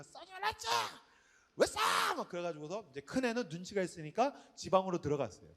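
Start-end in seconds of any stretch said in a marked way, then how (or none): 0.1-2.2 high-pitched head-voice shriek; imitated wife screaming. Wife scolding daughters who fought again, high screaming. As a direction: screech it high, like an exasperated mother. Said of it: "아내가 막 소리를 이제 지르는".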